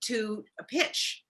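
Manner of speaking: usual speaking voice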